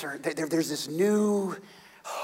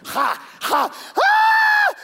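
very high, loud crowing ha; triumphant shout; 8 dB louder, much slower than usual. Acted. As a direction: cheer the victory wildly. Crowd cheering the kill.

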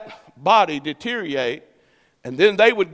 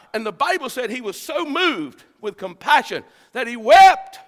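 raised-pitch sermon cadence in driving, repeated phrases; impassioned preaching; 7 semitones higher than usual. Real, not acted. Preacher tells people only God can deliver, impassioned and high. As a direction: pile up the phrases with fervor.

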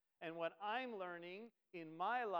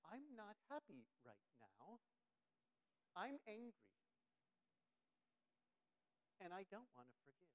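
mock sulking; 10 dB quieter than usual. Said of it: "a lot of us live like that".

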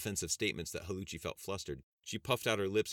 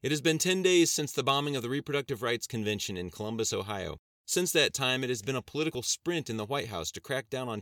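higher, louder news anchor read; TV host read; 5 semitones higher, 5 dB louder than usual. Acted. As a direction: deliver it like a talk show host on air. Host reads news intro.

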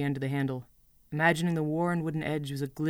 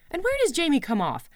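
higher, heated complaint; angry rant; 5 semitones higher, 3 dB louder than usual. Acted. Girl ranting to her goat about Jamie, angry. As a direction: vent the hurt, voice climbing.

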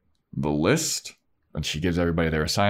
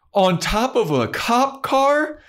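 very high, slow, louder horror; appalled Buffy; 5 dB louder, slower than usual. Retold or imitated. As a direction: daughter horrified by the details. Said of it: "and she goes".

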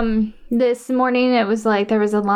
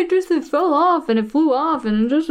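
high-pitched mock babbling excuse; flustered excuse-making; 6 semitones higher than usual. David explaining the piece that flew off, mock flustered. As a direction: imitate someone rambling defensively, pitched up.

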